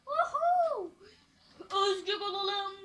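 loud, high, drawn-out holler; mock freedom cry; exuberant whoop. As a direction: whoop it out, stretching the words.

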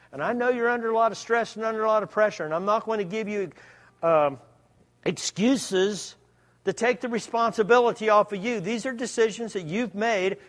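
loud, high, fast pleading voice; impassioned plea. Acted. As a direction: urge it out with rising fervour. Preacher acting the gentle helper.

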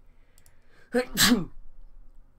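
sudden loud sneeze; a real, unplanned sneeze. Real, not acted. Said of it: "Sorry, awkward sneeze".

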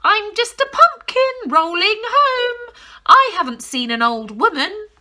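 high loud pretend-pumpkin voice; squeaky granny faking innocence; disguised granny. A narrator's reading. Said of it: "called the old woman from inside".

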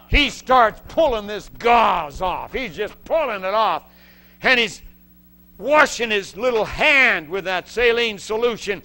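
loud, high, driving narration; preacher building the story's tension; intense retelling. Real, not acted. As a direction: push the story forward hard.

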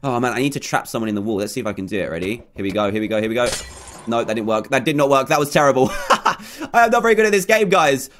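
ordinary talk, nothing marked

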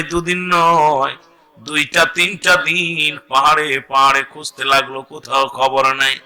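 normal talking voice

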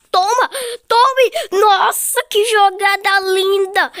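high, loud triumph; excited cheer. Real, not acted. Gamer celebrating a great move, high loud cheer. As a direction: shout it, then stretch the praise out slowly.

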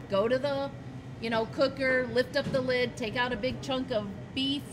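ordinary talk

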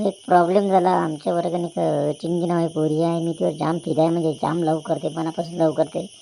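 ordinary talk

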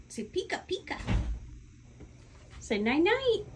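very high coaxing sing-song; high prompting voice for a bird. Real, not acted.

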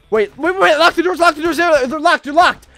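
play-acted panic, loud and high; frantic orders as zombies close in; urgent panic. Player shouts to lock the doors on zombies, urgent panic.

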